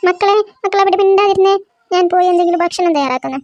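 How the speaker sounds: put-on mother shouting over the kids; loud scolding. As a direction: shout them into silence. Mother stops sisters' quarrel.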